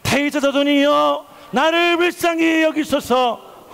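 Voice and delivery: slow, high, drawn-out cry from the gut; heartfelt cry. Shown as a demonstration. Preacher leading the congregation as Bartimaeus, heartfelt slow cry. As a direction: cry out for mercy from the soul. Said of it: "바디메오 같은 심정을 가지고 외쳐보시길 바랍니다".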